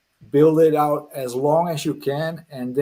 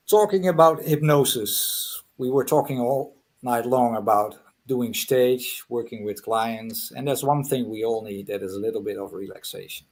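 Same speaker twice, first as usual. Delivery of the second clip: soothing induction, sinking gradually; guided hypnosis; 4 semitones lower than usual. Real, not acted. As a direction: ease them into relaxation with measured pauses. Starts leading a hypnosis relaxation.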